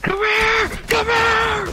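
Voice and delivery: high shouted call; shouting. Real, not acted. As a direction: yell it after the enemy. Calling out in-game chase.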